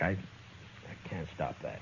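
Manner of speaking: a slow, hushed admission; whispered aside. Acted. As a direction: let it slip out under your breath. Dillon's resigned aside to Jane.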